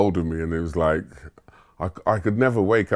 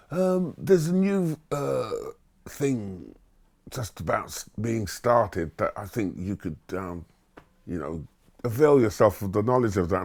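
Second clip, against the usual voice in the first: hesitant career master; 5 dB quieter than usual. Retold or imitated. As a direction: pause and hunt for words like a stuffy adviser.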